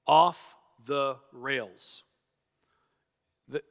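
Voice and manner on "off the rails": very slow, 'Off' stretched and leaned on, falling; emphatic verdict. Real, not acted. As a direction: drag out 'Off the rails' with disbelief. Preacher condemns argument.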